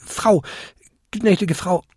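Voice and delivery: hohe, verlegene Koboldstimme; stammelnd. A narrator's reading. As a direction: suche stockend nach der richtigen Anrede. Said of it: "stammelte der kleinste Winterkobold". (stotternde Entschuldigung)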